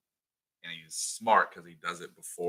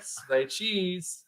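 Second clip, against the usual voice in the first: much higher, louder taunt; mock goofy tease; 10 semitones higher, 6 dB louder than usual. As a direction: sing it out to tease the kid. Marv teasing Kevin.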